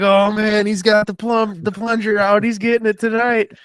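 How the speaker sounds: high, loud, over-the-top promo voice; hammed-up wrestling promo. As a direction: ham it up like a cartoon wrestler on the mic. Acting a wrestling character's hype.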